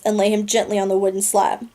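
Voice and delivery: ordinary delivery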